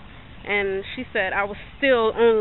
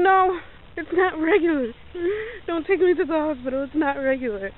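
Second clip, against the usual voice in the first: stubborn refusal; 6 semitones higher than usual. Retold or imitated.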